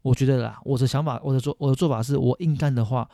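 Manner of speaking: ordinary delivery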